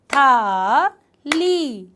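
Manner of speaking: था and ली said apart, one clap each; syllables split apart. Shown as a demonstration. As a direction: break थाली into था, ली. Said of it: "थाली को अलग-अलग अक्षरों में तोड़ें". (अक्षर अलग-अलग बोलना)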